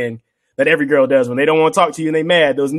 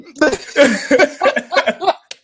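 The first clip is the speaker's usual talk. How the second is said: high laugh; 13 semitones higher than usual. Real, not acted. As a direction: crack up at your own bit. Laughing at the bit.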